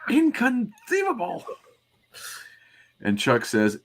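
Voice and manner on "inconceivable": high, shrill inconceivable; Vizzini impression. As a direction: shout it like Vizzini in disbelief. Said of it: "the nasal voice guy from Princess Bride".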